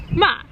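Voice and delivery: ma falling sharply, final; fourth tone ma. Shown as a demonstration. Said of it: "just going down and very final".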